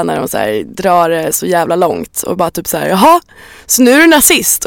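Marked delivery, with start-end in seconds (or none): 2.9-4.6 higher, pointed accusatory question; mock accusing. Mimicking people who overreact on Twitter, mock accusing. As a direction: imitate an indignant accuser, pitched up.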